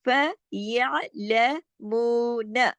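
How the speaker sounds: फयअलमून broken slowly, मू held long; very slow drilled recitation; syllable-by-syllable madd. Shown as a demonstration. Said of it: "इसको दो हरकत खींचना है".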